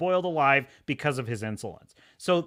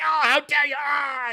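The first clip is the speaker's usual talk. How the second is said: higher, mock-furious shout; hammed-up enraged cry; raging scream; 9 semitones higher than usual. Blackthorne pinned and pissed on, mock raging shout. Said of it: "screaming in a rage".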